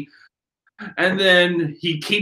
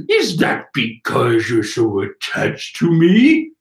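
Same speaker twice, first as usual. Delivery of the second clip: slow, drawn-out line with a rising end; wrong character's voice; 6 semitones lower than usual. Acted. Reading the wrong character's line, slow theatrical voice. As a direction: play it as the villain, slow and loaded. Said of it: "Oh, wait, no, that's her".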